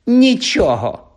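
високо, голосно й розтягнуто; випали це переможно, дражнячись; тріумфальне дражніння. Acted. Півз тріумфально дражнить Філча. (переможно дражниться)

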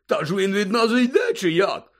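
high, loud, chuckling disbelief; boozy astonished character voice; surprised Hagrid. A narrator's reading. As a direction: puzzle aloud like a tipsy friend. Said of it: "здивувався Гегрід".

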